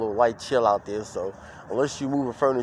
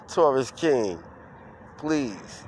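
ordinary delivery

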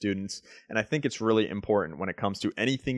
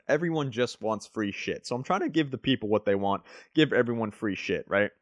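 normal talking voice

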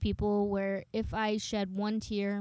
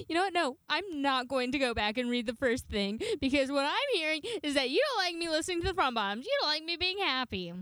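upset retort; 7 semitones higher, faster than usual. Retold or imitated. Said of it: "and I'm like".

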